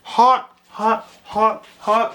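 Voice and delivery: panting words round scalding food; mouth full of hot food. Real, not acted. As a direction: gasp it out with a burning mouth. Hot food just tasted.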